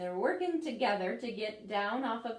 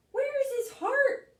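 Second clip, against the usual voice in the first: much higher and louder, startled; alarmed artichoke; 7 dB louder than usual. Acted. Artichoke stares at the X-ray, shocked and high. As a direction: gasp it out in shock. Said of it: "says the artichoke".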